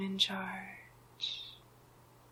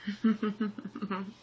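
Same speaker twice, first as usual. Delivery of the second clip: villain chuckle; 2 semitones higher than usual. Acted. Usurper pleased at taking the throne, gloating chuckle. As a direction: chuckle to yourself in rapid little pulses.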